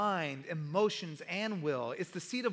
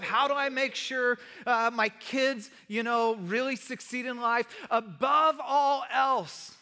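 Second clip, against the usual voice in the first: louder, building sermon emphasis; fervent preaching; 2 semitones higher, 4 dB louder than usual. Real, not acted. Pastor urging the church to put this above goals. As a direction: drive the point home to the room.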